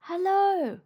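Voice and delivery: beaming loud greeting; joyful shout. Acted. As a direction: call out delighted on waking. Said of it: "he shouted joyfully".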